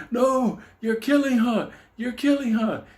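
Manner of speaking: high, loud mock-horrified scream. Man watching his doll get stabbed, screaming in mock panic. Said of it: "And he's screaming".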